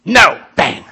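mock gruff innkeeper turning people away; hammed-up grumpy no, bang, grr; hostile retelling. Innkeepers slamming doors on Mary and Joseph, mock growling. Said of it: "we tell that story ... like this".

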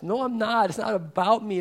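usual speaking voice

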